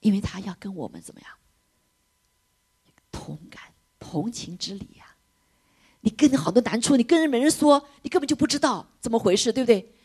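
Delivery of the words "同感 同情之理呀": slow hushed whisper; soft whispered empathy. Real, not acted. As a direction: whisper it tenderly. Preacher whispers key word.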